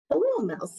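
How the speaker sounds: high duck greeting. Acted. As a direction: chirp the hello up high. Duck greets farm animals.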